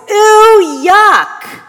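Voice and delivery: put-on high, loud child's shout of disgust; small girl crying out in disgust during communion. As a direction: squeal it out like a grossed-out little girl. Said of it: "said in a very loud voice".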